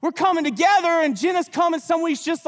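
usual speaking voice

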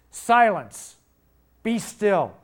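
high, loud command to the storm; commanding rebuke. Retold or imitated. Jesus rebuking the wind and waves, loud command. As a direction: order the waves to be still. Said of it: "rebuked the wind and said".

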